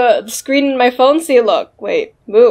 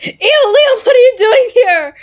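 high disgusted Cherry; 11 semitones higher than usual. Acted. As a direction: squeak out the disgust. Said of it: "the other Cherry is like".

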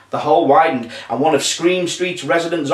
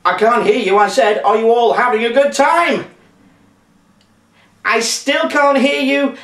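high-pitched, raised-voice tease to the audience; pantomime call-out; 9 semitones higher, 3 dB louder than usual. Pretend. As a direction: egg the crowd on to answer louder.

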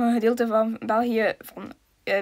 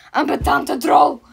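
annoyed; 2 semitones higher, 7 dB louder, slower than usual. Real, not acted. Geërgerd tegen speler.

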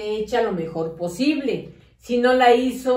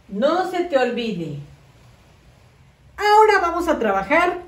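put-on talking to young kids. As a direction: coax the children along through the worksheet. Teacher addresses small children.

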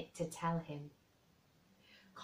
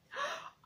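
delighted gasp. Acted. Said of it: "Colin cried out in delight".

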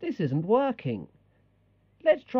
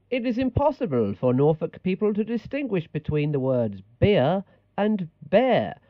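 ordinary talk

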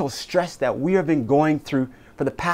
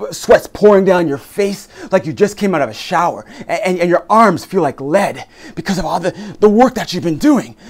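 frantic CPR; 4 semitones higher, 5 dB louder than usual. Acted. Acted CPR emergency scene.

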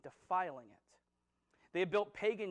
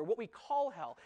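usual speaking voice

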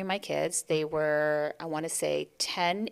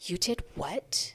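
slow, softer appalled "You did what?"; shocked disbelief; 6 dB quieter, slower than usual. Retold or imitated. Other mother appalled at walking home alone, slow and hushed. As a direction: let it sink in slowly, stunned. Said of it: "And she goes".

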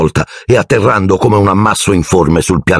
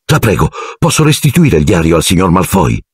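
ordinary delivery

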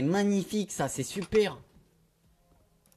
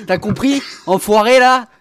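yelling; 4 semitones higher, 8 dB louder than usual. Real, not acted. Yelling at the cat.

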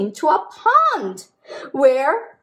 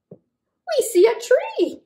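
excited discovery; 12 semitones higher, slower than usual. Acted. Reading picture to kids.

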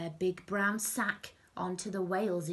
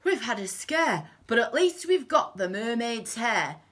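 louder, higher villain voice; relieved thief, pitched up; Hugh the crook; 5 semitones higher, 6 dB louder than usual. A narrator's reading. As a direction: recover from a scare, in character. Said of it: "said Hugh".

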